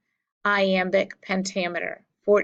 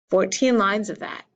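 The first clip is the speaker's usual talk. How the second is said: ordinary talk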